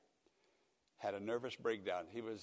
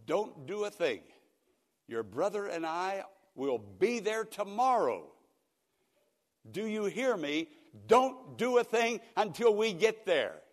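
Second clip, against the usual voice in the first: loud, higher, firm orders; commanding daughter; 9 semitones higher, 8 dB louder than usual. Retold or imitated. Daughter ordering her dad to wait, loud and firm. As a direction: she won't take no for an answer.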